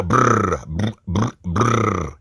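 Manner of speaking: "brr" trilled over and over, teasing; mock rolled brr. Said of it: "You guys brought that R with you".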